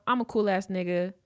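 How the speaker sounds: usual speaking voice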